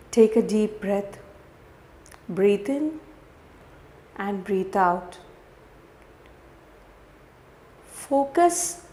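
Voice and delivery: guided meditation. Real, not acted. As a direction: guide the listener gently through each breath.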